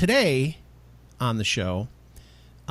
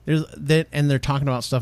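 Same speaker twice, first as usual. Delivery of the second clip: ordinary talk, nothing marked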